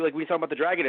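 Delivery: normal talking voice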